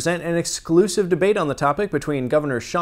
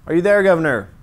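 louder call into the silence; 3 semitones higher, 5 dB louder, faster than usual. Real, not acted. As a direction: call for someone who isn't answering. Calling out to absent governor.

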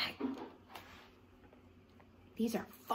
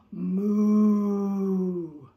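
noisy moo; 8 dB louder than usual. Shown as a demonstration. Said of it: "with a noisy moo".

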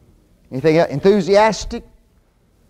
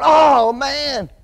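mock groan at the pot boiling over; dismayed oh man; 10 semitones higher than usual. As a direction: sing out a high, startled sigh of dismay. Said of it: "And you're going".

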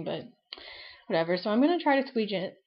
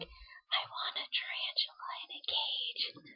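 slow secret whisper; a little girl whispering her birthday wish; 11 dB quieter, slower than usual. Retold or imitated. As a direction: whisper it so mom won't hear. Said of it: "she had to whisper it".

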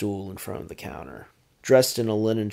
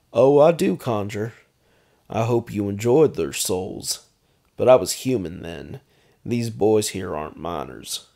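mocking vampire baron; 8 dB louder than usual. A narrator's reading. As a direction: needle him about his god. McKinn answers threat.

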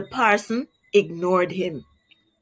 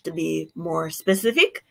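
plain reading voice

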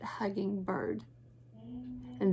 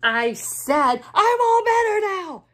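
high, loud shout; 10 semitones higher, 8 dB louder than usual. Acted. Bird shouts to crying friends, high and loud. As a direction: yell it over the crying, higher and louder. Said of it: "shouted Bird".

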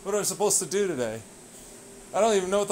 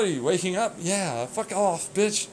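usual speaking voice

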